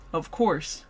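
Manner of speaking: plain reading voice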